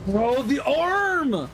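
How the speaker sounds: slow, drawn-out outraged cry; disbelieving cry. Real, not acted. Streamer watching the boss arm fall over, slow disbelieving cry. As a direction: stretch it out in disbelief. Boss's arm falls out of reach.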